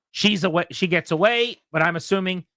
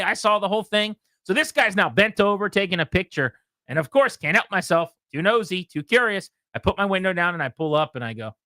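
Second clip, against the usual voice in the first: normal talking voice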